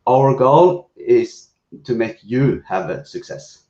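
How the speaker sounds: ordinary talk, nothing marked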